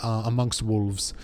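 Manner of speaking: earnest, loosely Welsh dialect voice; amateur Welsh accent, defending the lad; attempted Welsh accent. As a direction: vouch for a young comrade in a rough Welsh accent. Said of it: "trying to do a Welsh accent".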